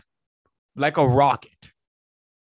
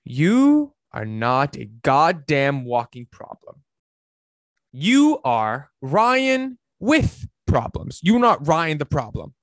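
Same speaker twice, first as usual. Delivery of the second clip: slow, weighty and a bit louder; slow emphatic message; 3 dB louder, slower than usual. Real, not acted. Coach to his viewers, slow and emphatic final message. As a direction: land each word slowly, like a final verdict. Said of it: "I want to leave you with this very, very powerful message".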